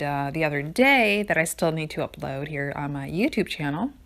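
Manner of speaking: ordinary talk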